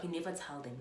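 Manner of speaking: ordinary talk, nothing marked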